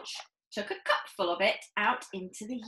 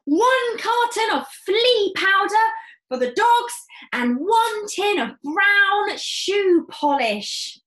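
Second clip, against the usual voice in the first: high, loud eager father, slowing at the end; an eager dad ticking off his shopping; father reporting his purchases, high and loud; excitable father voice; 11 semitones higher, 11 dB louder than usual. Acted. Mr Cranky voiced in story.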